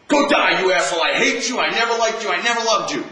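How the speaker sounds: high, loud hammed-up curse at someone; mock angry outburst. Teacher voicing bitter words that hurt for life, loud mock rage. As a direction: yell it like you mean to wound.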